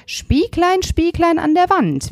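high, haughty queen calling the mirror; evil queen voice. Acted. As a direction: sing it out like a vain queen.

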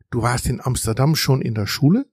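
usual speaking voice

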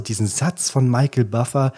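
normal talking voice

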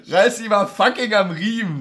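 lachende Zurechtweisung. Real, not acted.

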